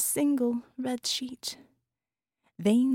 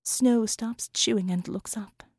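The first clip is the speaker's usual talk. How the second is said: plain reading voice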